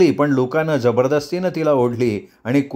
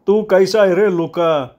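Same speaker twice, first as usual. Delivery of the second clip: high, slow, drawn-out greeting; chummy sidekick; 6 semitones higher, 3 dB louder than usual. Acted. Sidekick asks the boss how he is, chummy. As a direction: greet the boss in a raised, stretched voice. Friend greets Luca after making up.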